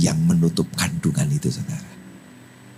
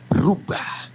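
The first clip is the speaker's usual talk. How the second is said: normal talking voice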